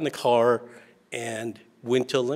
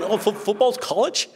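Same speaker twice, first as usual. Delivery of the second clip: put-on much higher, louder puzzled child voice; confused kids; 10 semitones higher, 4 dB louder than usual. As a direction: sound like a baffled little kid. Little kids confused about college.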